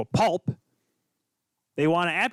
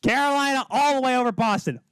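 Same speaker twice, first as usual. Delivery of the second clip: hyped up; 5 semitones higher than usual. Real, not acted. Host hyping Carolina's sweep over Boston fans. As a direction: pump up the crowd. Fired-up hockey prediction rant.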